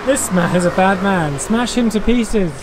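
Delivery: normal talking voice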